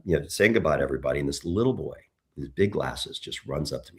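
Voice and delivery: ordinary talk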